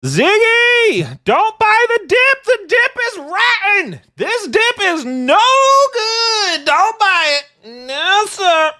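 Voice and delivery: very high, loud, slow squealing plea; high-pitched yell. Acted. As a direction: shriek the warning in a cartoon voice. Yelling a warning at the screen.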